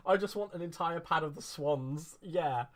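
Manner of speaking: laughing. Real, not acted. Crafter joking to viewers about wanting swan pads, laughing. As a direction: laugh through the wish.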